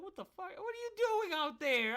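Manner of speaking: very high, loud mock outrage; acted incredulous shriek; shocked mom yelling. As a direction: screech it like an appalled mother. Retold mom's shocked reaction.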